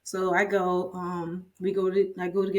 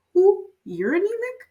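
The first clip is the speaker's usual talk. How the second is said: much higher, loud startled cry; shocked disbelief; 14 semitones higher, 8 dB louder than usual. Retold or imitated. Doctor hears her own nurse is anemic, shocked high cry. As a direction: squeal it in disbelief.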